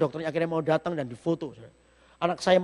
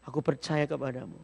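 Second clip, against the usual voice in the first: tearful prayer; 5 semitones lower, 8 dB quieter than usual. Retold or imitated. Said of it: "saya menangis".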